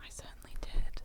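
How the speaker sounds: breathy spooky whisper. Pretend. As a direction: whisper it like a secret. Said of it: "just whisper into the mic again".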